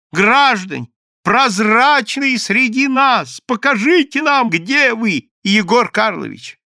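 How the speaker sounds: high, loud and slow proclamation; calling to the crowd. A narrator's reading. Польский shouts to the crowd, slow, loud and high. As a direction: announce it to the whole square. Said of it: "крикнул Иоанна Польский".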